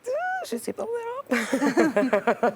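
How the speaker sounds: high shy squeal, drawn out; really shy, high-pitched and slow; bashful. Real, not acted. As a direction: dodge the question with embarrassed giggliness. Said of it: "Je suis une grande timide".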